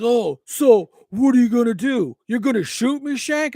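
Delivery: mock taunting stranger. Quoting taunting man.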